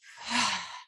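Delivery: audible open-mouthed sigh; exhaling sigh. Shown as a demonstration. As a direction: breathe out in one long whoosh. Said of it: "out through the mouth".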